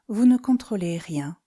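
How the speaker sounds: ordinary delivery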